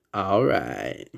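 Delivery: lightly done drawled "All right" in a borrowed voice; a hint of the laid-back movie-star catchphrase; Matthew McConaughey impression. Fan quoting Matthew McConaughey's catchphrase to viewers, lightly imitated. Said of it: "As Matthew McConaughey will say".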